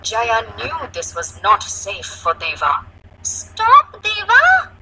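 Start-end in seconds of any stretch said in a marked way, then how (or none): 3.2-4.7 put-on high, loud child's yell. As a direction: yell to halt a runaway friend. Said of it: "she yelled".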